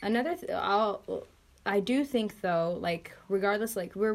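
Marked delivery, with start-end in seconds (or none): none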